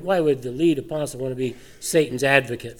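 normal talking voice

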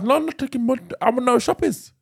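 put-on high, insistent Indian-accented protest; hammed-up Indian English; mock Indian accent. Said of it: "an Indian twang".

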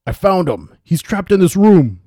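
high, loud holler; big drawn-out guard's yell; triumphant shout. A narrator's reading. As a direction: shout to the others that you've cornered him. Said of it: "as the guard shouted".

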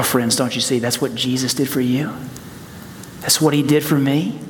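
normal talking voice

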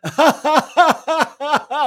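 loud, high, sustained belly laugh; forced hearty laughter. Shown as a demonstration. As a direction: laugh on purpose, big and long. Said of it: "Keep laughing".

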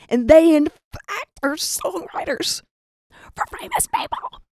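comic panic, losing the voice as it rises; panicked realization. Retold or imitated. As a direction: voice climbing into a tiny high squeak. Panicked realization, voice climbing away.

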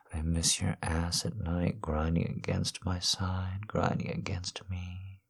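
soft, hushed lovers' murmur; intimate whisper. Acted. Murmuring longingly to his absent wife, hushed. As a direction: keep it close and hushed throughout.

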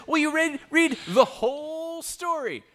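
preacher shouts his main point; emphatic shout. Real, not acted. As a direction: drive the point home. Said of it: "emphatic teaching point".

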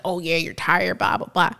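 ordinary talk

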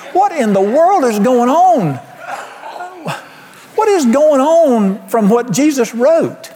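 high, drawn-out exasperation; exasperated outcry. Real, not acted. Preacher to congregation about hurt in churches, exasperated. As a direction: stretch it out, high and incredulous.

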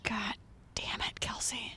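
hissed whisper, no voice at all. Retold or imitated. Muttering to herself after drinking, whispered. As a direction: whisper it under your breath, exasperated. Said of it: "I'd be like".

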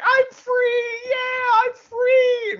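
high, loud, drawn-out shout of freedom; exuberant escape cry. Acted. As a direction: yell it like you've just busted loose. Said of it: "is just like".

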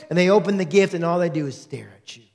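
ordinary talk, nothing marked

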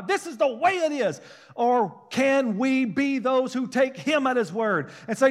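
high, ringing preacher's voice building to a cry; fervent preaching. Acted. Preacher urging his church not to give up, fervent. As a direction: preach it to the congregation with full force.

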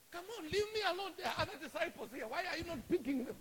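high, pushed complaint; acted indignant whine; exasperated protest. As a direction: plead to be left alone, annoyed. Acts Peter protesting.

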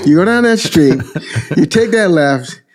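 high Brooklyn directions with a laugh in it; Brooklyn Italian guy. Retold or imitated. Brooklyn Italian truck guy giving a stranger directions, Brooklyn accent. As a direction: give the route like a chuckling Brooklyn local. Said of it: "very Brooklyn, New York, Italian guy".

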